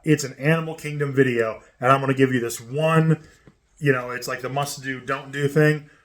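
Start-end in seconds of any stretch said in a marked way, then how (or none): none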